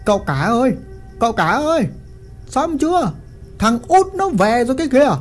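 urgent call through a doorway; calling in from outside. A narrator's reading. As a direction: servant calling to his master from outside the door. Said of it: "nói vọng vào".

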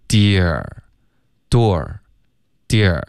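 DEAR as "dyeh-r", DOOR as "dwo-r", slowly alternating; contrasted glides. Shown as a demonstration. Teacher contrasting two glides for students, very slow demo. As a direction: alternate dear and door, drawn out with pauses between. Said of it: "干爹的区别".

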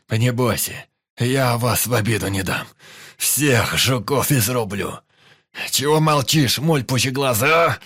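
loud, high boastful shout; raging bravado. A narrator's reading. Пётр орёт на отряд после боя, в ярости. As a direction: roar it, half mad with adrenaline. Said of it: "орал Пётр".

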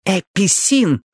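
high, spiteful hiss. A narrator's reading. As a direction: spit the word out with venom. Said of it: "прошипела Пиппа Миджли".

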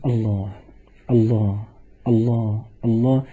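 low, soft, retold murmur of a dying woman; unhurried soft repetition, each word set apart by a pause; dying mother's repeated invocation. Son retelling his dying mother's last words, low and soft. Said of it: "وهي لا تفتر عن لفظ".

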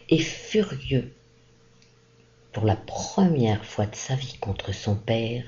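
ordinary delivery, nothing marked

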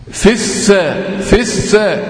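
"في السا" as fis-saa, leaning on both s sounds; doubled s shown. Shown as a demonstration. Teacher making students count the s sounds, "في السا" as fis-saa. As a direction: link "في السا" with short i and doubled s, twice. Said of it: "كم سين؟".

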